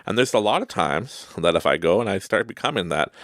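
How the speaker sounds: ordinary talk, nothing marked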